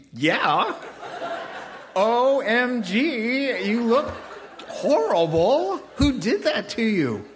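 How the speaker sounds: loud, high, drawn-out comic shock; gasp-and-gush at a disastrous haircut, hammed up; over-the-top appalled reaction, stretching each word; mock horror. Acting a tactless horrified reply.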